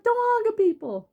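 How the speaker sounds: high-pitched mock scolding; retold scolding. Fallen woman telling off the honker, high mock scolding. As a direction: scold in a lifted, aggrieved voice.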